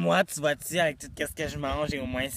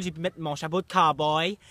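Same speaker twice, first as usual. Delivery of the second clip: ordinary talk, nothing marked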